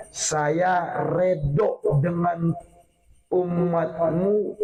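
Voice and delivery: very slow, word by word; solemn slow promise. Retold or imitated. Preacher voices Allah's promise to Muhammad, very slow and deliberate. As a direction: draw out every word deliberately.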